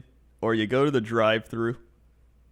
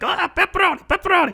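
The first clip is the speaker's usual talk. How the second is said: put-on much higher squealing kid voice; nerdy kid; 4 dB louder, faster than usual. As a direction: chant pepperoni like a nerdy little kid. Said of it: "nerdy kid with glasses just being like".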